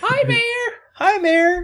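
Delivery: very high, cheery calls; high squeaky greeting. Retold or imitated. Quoting townsfolk greeting.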